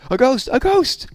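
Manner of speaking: high, loud panicked shriek; frightened cry. Acted. Little Bear shrieking at a sheet that looks like a ghost, high and panicked. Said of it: "he cried".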